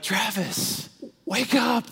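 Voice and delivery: voice of God. Retold or imitated. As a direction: gently wake him as God would. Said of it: "God was like".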